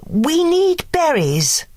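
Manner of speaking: put-on a sweet high old fairy; Fauna's voice. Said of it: "Fauna said".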